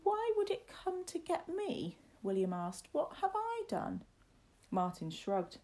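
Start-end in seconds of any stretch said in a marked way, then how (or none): 0.0-2.0 put-on very high, fearful child voice; panicked boy. A narrator's reading. Boy asks why the monster wants him, high panic. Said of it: "towards the tower in panic". 2.9-4.0 put-on very high, plaintive child voice; panicked boy. Boy protests his innocence to the lad, high and panicked.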